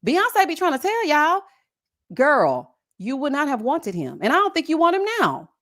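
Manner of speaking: ordinary delivery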